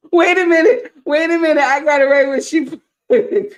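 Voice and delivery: high, squeaky voice from laughing; real helpless, high-pitched amusement; talking through laughter. Real, not acted. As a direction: gasp out words mid laughing fit. Trying to talk through laughing fit.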